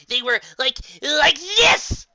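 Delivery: high, loud aghast cry; indignant wail. Acted. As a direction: present the horror dramatically. Trixie shows ruined hat.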